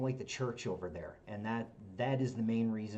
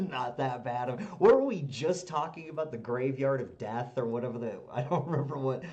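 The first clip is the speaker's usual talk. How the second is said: laughing; 4 semitones higher, 8 dB louder than usual. Real, not acted. Still laughing, flustered.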